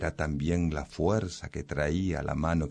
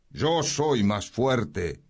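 very slow, higher proud claim; boastful sword; 6 semitones higher, much slower than usual. Acted. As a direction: drag out the boast triumphantly. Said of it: "volvió a decir la espada".

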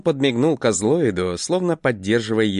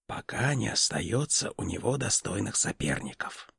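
hushed, much softer secret tone; whisper; 8 dB quieter than usual. Acted. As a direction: lean in and whisper it. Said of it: "продолжив шёпотом".

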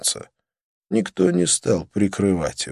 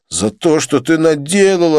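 angry retort; 7 semitones higher, 4 dB louder than usual. A narrator's reading. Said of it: "ответил Джонни".

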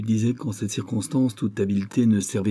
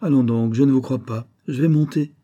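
raised and higher, brushing him off; impatient; 4 semitones higher, 5 dB louder than usual. Acted. Lily brushes off Gus's lie, impatient and raised. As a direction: insist sharply that you're going up. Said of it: "dit-elle avec impatience".

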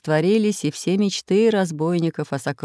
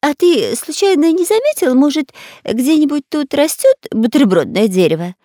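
whiny spy voice; 8 semitones higher, 7 dB louder than usual. Acted. Spy whining for food.

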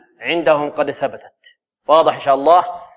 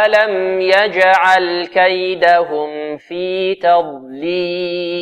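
slow melodic chant; tajweed chant; Quranic recitation; much slower than usual. Acted. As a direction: lead the class line by line. Recitation for class repetition.

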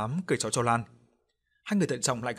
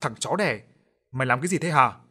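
angry possessed snarl, louder at the end; giọng ồm ồm giận dữ; 5 dB louder than usual. A narrator's reading. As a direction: curse him out like a possessed wife. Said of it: "hét lên bằng cái giọng ôm ôm". (quát giận dữ, giọng ồm ồm)

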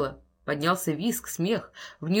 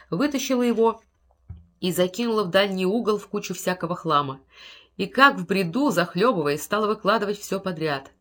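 ordinary delivery

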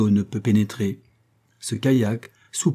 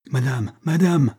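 mild urgent call, rising on the repeat; 3 semitones higher than usual. A narrator's reading. Lieutenant calls to the woman in the sinking canoe, mild urgent cry. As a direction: call out to her, understated. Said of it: "s'écria le lieutenant".

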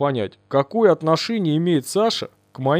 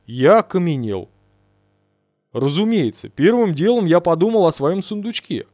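usual speaking voice

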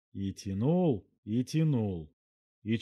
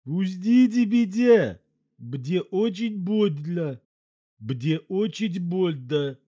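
high, slow, nasal elephant voice, words mangled; talking through his nose; 7 semitones higher, 8 dB louder, slower than usual. A narrator's reading. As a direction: squeeze it out through a stretched nose, in pain. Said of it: "проговорил через нос".

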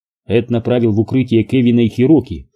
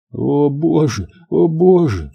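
higher, distressed repeated exclamation; 4 semitones higher, slower than usual. A narrator's reading. Samantha facing the blast, repeating it in distress. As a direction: repeat it, rising in pitch with dismay.

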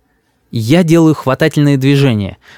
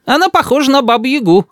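put-on very high child's voice; cheeky little boy; 13 semitones higher than usual. A narrator's reading. Boy compares grandmother to a witch, cheeky. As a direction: deliver the insult up high, cheekily.

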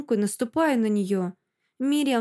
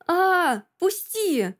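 much higher-pitched squeal of protest; shrieking; 8 semitones higher, much slower than usual. A narrator's reading. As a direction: squeal to be let go. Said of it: "продолжает верещать Мириам".

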